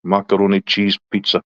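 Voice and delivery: ordinary delivery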